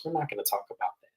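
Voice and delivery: soft, fast, clipped-off refusal; curt shutdown. Retold or imitated. Lisa refusing to discuss the puppet strings, soft and fast. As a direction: close the subject quickly and quietly.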